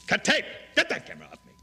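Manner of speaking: loud high call to the crew; shouted order. Real, not acted. Telling the crew to stop taping, a loud high shout.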